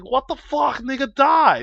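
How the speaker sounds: high, loud furious scream; retold furious yell. Kid screaming at the toy as he attacks it, furious. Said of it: "And I was like".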